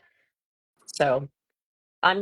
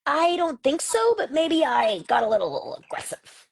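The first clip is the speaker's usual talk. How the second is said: put-on child's hedged admission; 11 semitones higher than usual. Son answering mom about throwing chairs, hedging. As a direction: imitate a boy reluctantly owning up.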